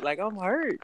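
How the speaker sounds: ordinary delivery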